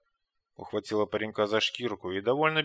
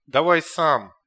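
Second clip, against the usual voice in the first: loud urgent shout; shouting orders over battle; 4 semitones higher, 10 dB louder, slower than usual. A narrator's reading. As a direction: yell it over the noise. Ната торопит в бою. (громкий окрик)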